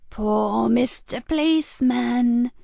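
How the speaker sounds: slow, taunting sing-song; mock pity; mocking villainess. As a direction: savour the cruelty. Frankie mocking the policeman.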